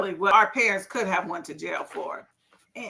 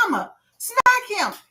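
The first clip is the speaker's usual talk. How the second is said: slow, higher mock plea to grandma; mother asking grandma; 9 semitones higher than usual. Mother urges grandma to smack cussing son, slow higher plea. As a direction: ask your mother to discipline him, half-joking. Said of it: "And then I say".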